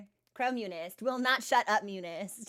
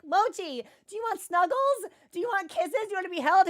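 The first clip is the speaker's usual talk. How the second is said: higher sing-song pet talk, offering snuggles; baby talk to pet; 6 semitones higher than usual. Retold or imitated. Owner cooing at Mochi, higher sing-song baby talk. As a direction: coo at the cat to win him over. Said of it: "I'm like".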